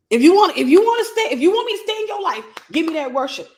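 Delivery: loud, high, urgent acted ultimatum; preacher voicing a demon's insistent demand. Acts out demon demanding worship.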